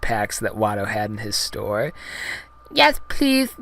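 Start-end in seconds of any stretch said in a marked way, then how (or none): 2.7-3.5 very high alien falsetto; Jar Jar Binks. Acted. Gungan with numb tongue accepting help, very high goofy voice. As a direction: plead in a silly high creature voice. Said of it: "said Jar Jar".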